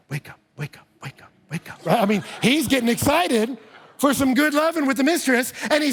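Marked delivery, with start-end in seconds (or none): 0.1-0.9 low, murmured wake up; murmured coaxing; soft wake-up call. Retold or imitated. Husband waking wife for love. 1.0-1.8 breathy whisper, lower still; whispered wake-up. Retold or imitated. Husband waking wife for love.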